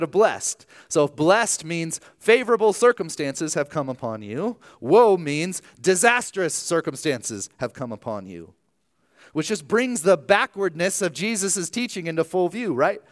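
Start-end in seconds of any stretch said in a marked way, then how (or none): none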